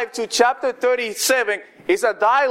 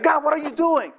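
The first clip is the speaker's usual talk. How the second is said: mildly furious, pitched-up protest; 6 semitones higher than usual. Acted. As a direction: demand an answer in a higher, indignant voice. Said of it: "And David just furious".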